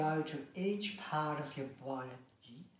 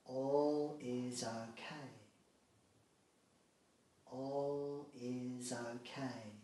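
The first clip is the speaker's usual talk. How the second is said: very slow, drawn-out mantra, growing softer; calming affirmation; 4 dB quieter, slower than usual. Shown as a demonstration. Modelling an affirmation for a relaxation listener, slow soothing mantra. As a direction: stretch each word out and let it settle quietly.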